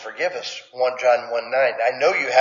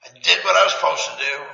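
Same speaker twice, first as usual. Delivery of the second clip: grudging sullen. Retold or imitated. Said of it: "throw it at the table".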